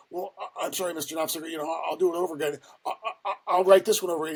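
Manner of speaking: retold nervous babble; flustered apology. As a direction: play a cowed rookie scrambling to make amends. Cowed rookie apologising to yelling boss.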